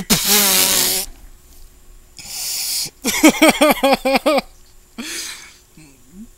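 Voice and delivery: high, loud, helpless laughter; real, breathless cackling laugh; long laughing fit. Real, not acted. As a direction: lose it completely at the word. Cracks up again at "hokey.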